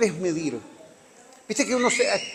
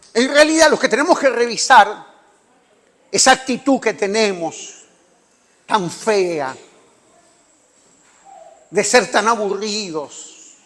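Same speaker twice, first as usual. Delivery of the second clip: louder, rising exhortation; preaching at full force; 3 semitones higher, 6 dB louder than usual. Acted. Preacher scolding his church for their boredom, fervent and loud. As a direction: press it on the congregation.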